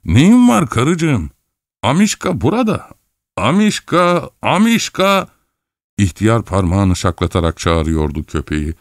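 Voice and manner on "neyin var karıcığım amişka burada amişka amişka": put-on old husband. A narrator's reading. Husband calling his wife's little dog, high calling voice. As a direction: call out fondly in a raised pitch.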